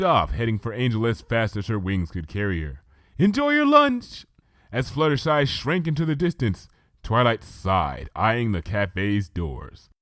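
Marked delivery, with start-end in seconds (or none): 3.2-4.3 much higher, louder cheerful call. Acted. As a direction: shout it back over your shoulder. Fluttershy calls while flying off.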